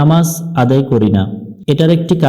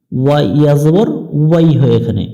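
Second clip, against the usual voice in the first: ordinary talk, nothing marked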